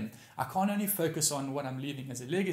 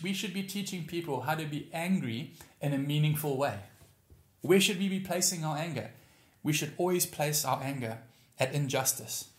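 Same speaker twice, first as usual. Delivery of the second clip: ordinary talk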